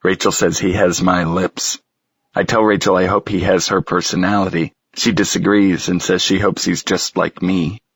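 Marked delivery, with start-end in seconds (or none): none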